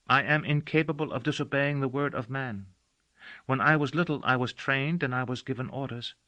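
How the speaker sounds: put-on earnest and solemn, a child explaining her nature; a girl gravely telling a man what she is; serious girl. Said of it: "Her voice turned serious".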